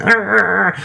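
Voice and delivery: imitating a high pained groan; a mock groan of pain from the car roof. Friend imitates Ben groaning on the car roof, high pained groan.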